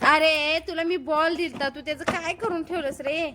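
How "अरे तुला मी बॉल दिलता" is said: normal talking voice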